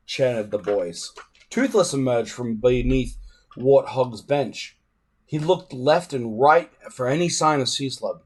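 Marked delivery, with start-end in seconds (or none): none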